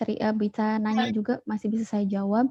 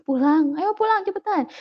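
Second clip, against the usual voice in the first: high, louder hurrying-up call; raised, urgent motherly command; retold impatient order; 7 semitones higher, 5 dB louder than usual. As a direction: imitate a mother ordering her child along. Mother hurrying child home, retold.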